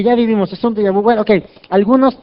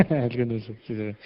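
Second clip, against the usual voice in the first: chuckling, softer and lower; laughing; 5 semitones lower, 6 dB quieter than usual. Real, not acted. Preacher laughing at his mirror joke, chuckling. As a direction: laugh through the words.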